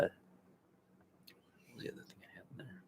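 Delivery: whispered aside to himself; whispering. Real, not acted. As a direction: drop to a whisper, thinking aloud. Host muttering to himself mid-list.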